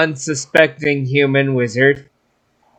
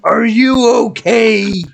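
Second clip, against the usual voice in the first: giant's voice; 8 semitones higher than usual. Acted. Player voices giant to sickly man.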